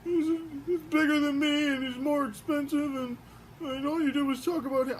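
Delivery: high whiny voice of a jealous action figure; jealous toy voice. Acted. Toy figure Jamie complaining to his owner, high jealous whine. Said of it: "He's like".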